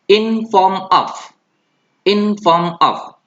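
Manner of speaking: "in form of" lambat dan tinggi; slow model phrase. Shown as a demonstration. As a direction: ucapkan "in form of" pelan untuk ditiru. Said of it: "coba ulangi seperti yang saya ucapkan". ("in form of" lambat untuk ditirukan)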